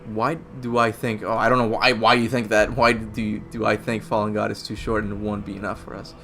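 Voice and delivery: usual speaking voice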